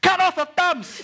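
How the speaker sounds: shouted demand. Acted. Friend calls for revenge on a rival, shouting. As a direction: yell it like a battle order.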